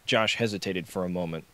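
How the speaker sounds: ordinary delivery, nothing marked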